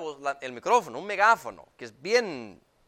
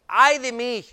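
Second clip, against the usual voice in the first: loud, raised cry of a prophet in distress; Isaiah's lament; 6 semitones higher, 7 dB louder than usual. Retold or imitated. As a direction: cry out as Isaiah overwhelmed by God's holiness. (lamento del profeta Isaías)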